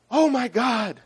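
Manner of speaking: high-pitched cry for help; desperate call-out. Acted. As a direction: cry out as the bear is about to strike. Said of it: "the guy calls out".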